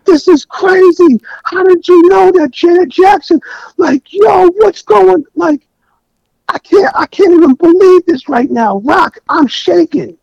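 high, loud frantic shout of disbelief, slowing as he trembles; overwhelmed with excitement. Retold or imitated. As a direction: yell it like a friend losing his mind with joy. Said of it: "He's losing it. He's pacing back and forth".

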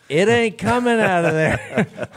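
loud, high, drawn-out punchline; emphatic comic verdict. Retold or imitated. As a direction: belt it out slowly with a laugh in it. Joking verdict on the stuck truck.

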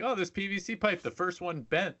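lightly acted oblivious thought; mock clueless self. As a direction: imitate someone naively reasoning to himself. Voices older self's foolish reasoning.

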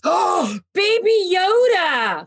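high, drawn-out gushing squeal of the name; gasp, then coo it slowly and high; real delight. Real, not acted. Host spots a Baby Yoda figure, delighted.

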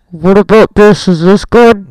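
booming mic test, very loud; right up on the mic. Real, not acted. Repeating the mic test for a co-host, blaringly close and loud. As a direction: crowd the microphone and overload it. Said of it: "people are not happy with you".

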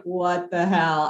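usual speaking voice

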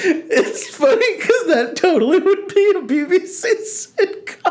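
words squeezed out through high laughter; can barely talk for laughing; laughing hard. Real, not acted. Cracking up at sitcom joke.

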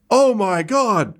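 high, pumped-up ringside call; excited arena announcer. Acted. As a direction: hype the crowd like a fight commentator. Arena announcer calls the fight.

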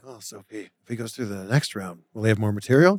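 hushed, low conferring; mock whispering. Host imagining the producers' discussion, mock whispering. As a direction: pretend to confer under your breath. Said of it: "the whispering was probably like".

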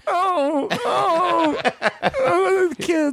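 very high crying whine; whiny panicked dad. Retold or imitated. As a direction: wail like a frantic nerdy father. Rick Moranis whine.

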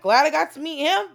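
usual speaking voice

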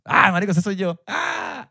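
high, amused reaction ending in a squeal; imitating a laughing Venezuelan. Friend imitates a Venezuelan seeing the story, amused high squeal. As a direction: play a Venezuelan cracking up at himself. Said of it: "un venezolano normal ve esa historia".

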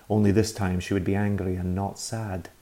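ordinary delivery

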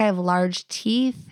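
ordinary talk, nothing marked

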